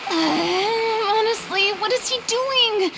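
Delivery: exasperated complaint. Real, not acted. Annoyed at unannounced thanks.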